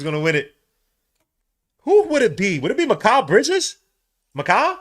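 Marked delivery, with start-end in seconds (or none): 1.8-4.8 higher, louder eager questions; itching to hear who wins; excited guessing. Real, not acted. Waiting for MVP announcement.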